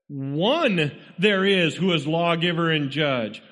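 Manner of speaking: One up front, pitched above the rest; strong emphasis on the opening One; stress on One. Shown as a demonstration. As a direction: hit One strongly, then let the rest follow. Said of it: "putting a very strong emphasis on it".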